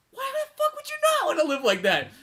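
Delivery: high-pitched comic disbelief; mock incredulous. Co-host arguing with self-pitying cyborgs, high incredulous rant. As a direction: squeak it out in outraged amazement.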